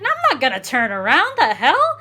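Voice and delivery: retelling her loud outraged no; indignant retold shout. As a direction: push him off, loud and high. Retelling refusing a pushy date.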